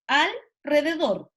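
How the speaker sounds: "Alrededor" slowly, with a stretched "Al" set apart from "rededor"; split in two. Shown as a demonstration. Wondering if it is written joined or separate, "Alrededor" split in two. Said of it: "si es junto o separado".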